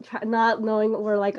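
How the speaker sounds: normal talking voice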